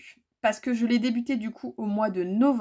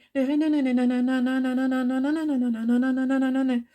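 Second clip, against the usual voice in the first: rapid run of nanana on one raised note; mock fast-forward babble; 3 semitones higher than usual. Vlogger skipping past her long aside, mock fast-forward babble. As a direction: babble like a tape speeding up. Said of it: "accélérer".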